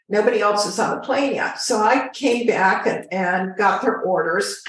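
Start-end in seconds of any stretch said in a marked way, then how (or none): none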